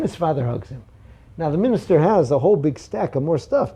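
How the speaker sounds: normal talking voice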